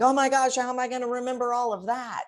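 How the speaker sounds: high, rushed mock panic; mock overwhelmed. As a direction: act out a flustered, overwhelmed thought. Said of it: "because you might think".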